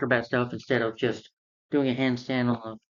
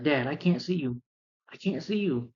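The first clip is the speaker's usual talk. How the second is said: higher, strained and fading; anguished plea; 4 semitones higher than usual. Retold or imitated. Blind young Matt calls to his dead father, anguished. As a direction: reach out to someone you can't find.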